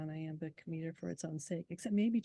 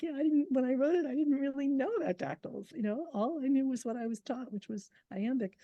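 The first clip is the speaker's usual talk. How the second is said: defensive self-quote; 8 semitones higher, 5 dB louder than usual. Retold or imitated. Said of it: "And I'm like".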